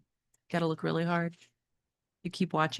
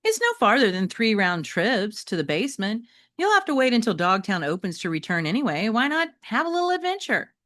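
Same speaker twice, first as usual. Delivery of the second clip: persuasive Metalhead; 6 semitones higher, 5 dB louder than usual. Acted.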